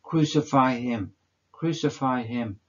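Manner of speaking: every voice in heaven and hell crying for his death. Acted. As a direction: hurl it like the whole crowd at once. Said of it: "cries out".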